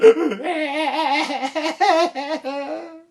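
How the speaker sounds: high, loud comic wail; exaggerated bawling. Princess crying her eyes out at the window, loud wailing. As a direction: bawl in long shuddering sobs. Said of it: "she's still crying her eyes out".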